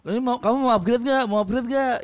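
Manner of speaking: loud high hawking pitch; loud street seller. Retold or imitated. Seller voiced hawking to kids.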